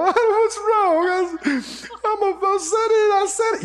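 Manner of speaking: high-pitched whiny fake sobbing; mock crying. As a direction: wail it high, imitating someone crying in complaint. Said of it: "then they cry and go".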